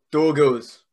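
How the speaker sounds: loud mock-Scandinavian "doggos"; Scandinavian twinge. Shown as a demonstration. Wrestler coaching host on "doggos", Scandinavian accent. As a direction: lean on "dog" in a Scandinavian accent. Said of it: "it's all about the dog".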